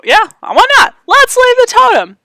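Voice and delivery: high playful change of heart; excited. Real, not acted. Sudden playful change of mind.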